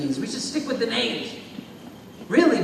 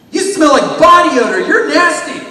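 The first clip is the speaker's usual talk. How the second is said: higher and much louder jeering; loud mock bully taunt; 8 semitones higher, 14 dB louder than usual. Bully shouts an insult at the kid, loud mock taunt. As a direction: hurl the insult like a playground bully. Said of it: "They might say".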